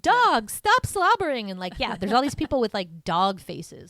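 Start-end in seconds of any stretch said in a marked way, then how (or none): 0.0-1.5 mock cat lady scold.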